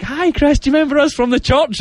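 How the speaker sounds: high, fast mock churchgoer greeting; chirpy voice of the church ladies spotting him; imitating churchgoers. Churchgoers greeting Chris, high mock voice. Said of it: "they're like".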